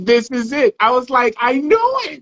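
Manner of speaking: high-pitched, thrilled "I knew it"; excited recognition. Retold or imitated. Host retells hearing the author's voice, excited recognition.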